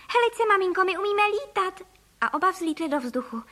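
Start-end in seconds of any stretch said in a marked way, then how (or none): 0.1-1.8 put-on high, chirpy child voice; chirping. A narrator's reading. Bird-boy showing mother he can fly, chirping. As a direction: pipe it up like a little bird. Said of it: "cvrlikal Filip".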